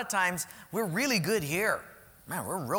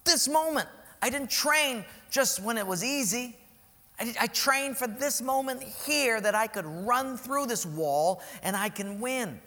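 normal talking voice

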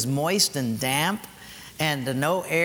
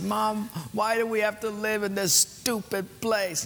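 put-on childlike, a bit higher, mildly tearful; a hint of a small boy whining to mom; crying child; 5 semitones higher than usual. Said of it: "and I was crying".

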